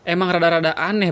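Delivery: normal talking voice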